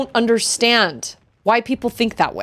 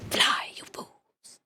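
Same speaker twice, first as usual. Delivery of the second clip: slow, drawn-out whisper; hushed whisper; 10 dB quieter, much slower than usual. Acted. Telling the fools to fly, dramatic whisper. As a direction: whisper it as a dramatic parting warning. Whispered add-on after shouted order.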